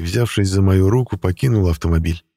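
plain reading voice

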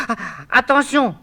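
sudden frightened warning; alarmed cry. Acted. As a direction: call out a quick warning. Eurydice warns again.

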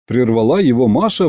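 plain reading voice

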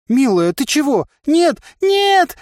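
high, loud, desperate cry; panicked. A narrator's reading. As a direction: beg in panic. Комментарий, сцена испуга. (в панике кричит)

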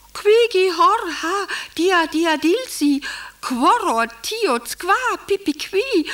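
high chirped qui gi hor ha, dia dia; birdcall reply. Acted. Wife answering the husband in birdcall code, high chirping. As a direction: chirp back like a bird. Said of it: "Und ich antworte in den Hörer".

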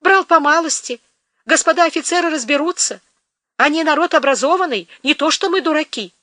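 high, brisk cheerfulness; forced cheer. Acted. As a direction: put on a brave face. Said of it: "говорил бодро Федот".

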